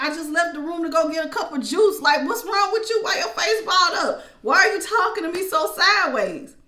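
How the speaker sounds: high, loud bewildered complaint; rising, loud, baffled indignation; exasperated protest. Retold or imitated. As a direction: plead in mock disbelief at a mood swing. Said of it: "and you just like".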